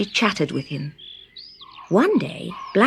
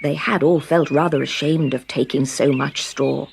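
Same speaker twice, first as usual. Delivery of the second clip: ordinary delivery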